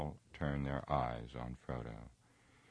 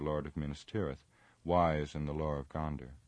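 ordinary delivery, nothing marked

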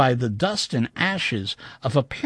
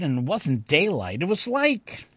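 ordinary delivery

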